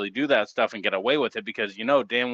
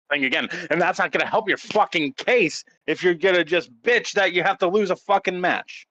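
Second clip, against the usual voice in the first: angry rant; 6 semitones higher than usual. Real, not acted. Ranting at wrestlers' complaints.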